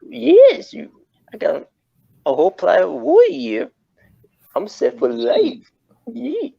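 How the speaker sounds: high, slow goofy wrestler voice; hammed-up character voice. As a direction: ham it up like a wrestling heel. Jokey put-on character bit.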